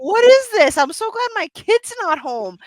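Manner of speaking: high, loud mock alarm; retold outburst of a scandalized parent; shocked parent reaction. Parent retelling a racy in-game ad to podcast co-hosts, mock-shocked.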